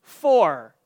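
"for" repeated loud, standing alone; emphatic FOR. Real, not acted. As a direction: land hard on "for" to flag its importance. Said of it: "that's very important".

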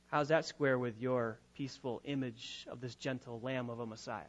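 usual speaking voice

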